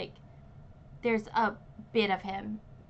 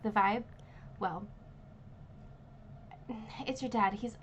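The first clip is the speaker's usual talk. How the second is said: normal talking voice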